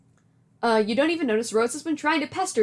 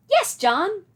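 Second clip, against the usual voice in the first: Nana voice; 7 semitones higher, 4 dB louder than usual. Acted. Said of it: "Do you like my Nana voice?".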